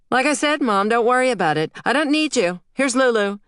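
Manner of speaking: high, fast, sharp retort; bitter child. A narrator's reading. Angry daughter tells her mother she doesn't need her, high bitter retort. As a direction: snap it to wound her.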